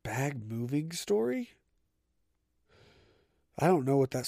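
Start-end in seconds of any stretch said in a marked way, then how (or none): 0.0-1.5 slow, soft, rising question; puzzled read-out. Real, not acted. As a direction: read it off slowly as if baffled. Puzzled reading of own note.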